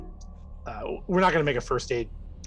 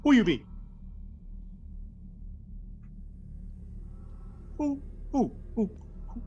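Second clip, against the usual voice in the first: indignant driller; 10 semitones higher, 4 dB quieter than usual. Acted. GM voices driller challenging.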